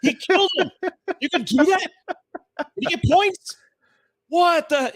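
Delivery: high, loud freaked-out exclaiming, acted out; imitating a stunned arcade crowd; shocked disbelief. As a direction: yelp in hammed-up amazement.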